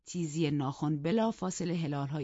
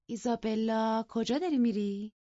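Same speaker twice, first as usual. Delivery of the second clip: a hint of playful higher voice; lightly teasing call; 6 semitones higher, 4 dB louder than usual. Catherine stopping Isabella from leaving, lightly teasing. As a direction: call her back with mock innocence.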